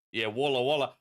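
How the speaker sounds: ordinary talk, nothing marked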